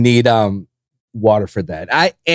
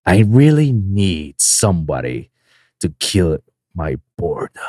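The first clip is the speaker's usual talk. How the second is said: villain voice; 10 semitones lower than usual. Shown as a demonstration. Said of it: "give you a little preview".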